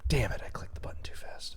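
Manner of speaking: annoyed whisper under breath; whispered curse. Real, not acted. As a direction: hiss it to yourself, annoyed. Annoyed at own mistake.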